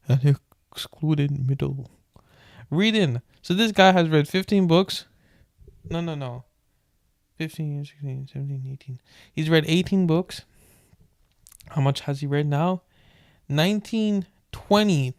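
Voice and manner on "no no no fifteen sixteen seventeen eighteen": very soft counting under breath; muttering. Real, not acted. Muttering count while flipping pages.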